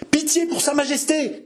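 high-pitched cry for mercy; cried plea. A narrator's reading. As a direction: call out a plea over a mob. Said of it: "cria la plus jeune des deux femmes". (supplication criée, très aiguë)

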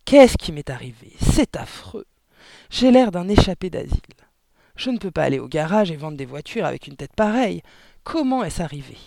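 horrified outburst at the mirror; loud dismay. Acted. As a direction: cry out, appalled at yourself. Father appalled at his reflection. (exclamations fortes et consternées)

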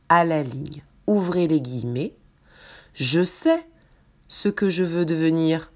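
slow, clear dictation voice; dictating. Acted. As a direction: read it out slowly word by word. Dictation read to the class.

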